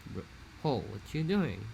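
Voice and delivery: slow, hazy Hawaiian Pidgin "Bro, oh, what you doing?"; new-generation Pidgin, soft and drawn out. Shown as a demonstration. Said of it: "now it's more like".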